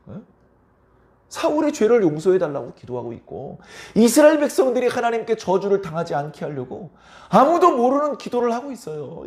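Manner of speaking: normal talking voice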